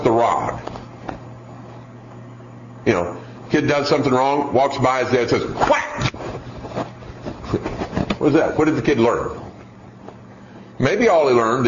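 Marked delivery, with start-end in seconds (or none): 5.5-6.1 mock whack noise. Imitating a dad's whack.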